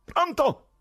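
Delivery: high, loud calls; shouting. A narrator's reading. Ivan checking the police can hear, shouting. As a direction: shout high into a dead line. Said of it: "e poi gridò di nuovo".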